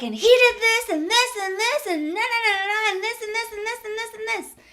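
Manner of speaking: high, whining tirade trailing down in volume; going off, complaining. Retold or imitated. Whining to her sister about her circumstance, complaining. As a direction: reel off grievances in a high, mocking whine. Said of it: "I was just going off, complaining".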